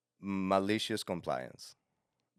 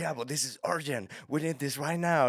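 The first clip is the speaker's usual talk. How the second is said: higher-pitched imitation of a pressing manager; retold urgent plea; 7 semitones higher, 4 dB louder than usual. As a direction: push back urgently as the boss. Manager pleads urgency in retold quarrel.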